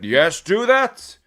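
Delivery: Saruman voice. Retold or imitated. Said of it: "And he's like".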